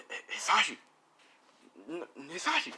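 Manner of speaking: mock distress, stumbling on the name; distressed cry. Wife calling to her fallen husband, acted distress.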